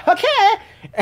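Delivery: sudden high squeaky shouted okay; mock pageant contestant. Mocking Miss Philippines' reaction.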